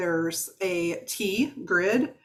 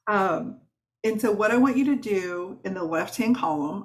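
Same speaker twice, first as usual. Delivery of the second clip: ordinary talk, nothing marked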